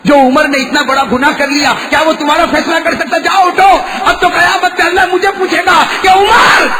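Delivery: high, loud sermon shout; impassioned preacher at a high pitch; full-force preaching. Acted. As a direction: thunder it out to the crowd.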